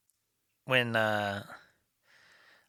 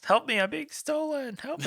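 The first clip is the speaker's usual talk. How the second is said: high, squeaky cry for help from a little vegetable. Pretend. Mocking the talking vegetables, high squeaky plea. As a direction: squeak it out like a panicked cartoon vegetable.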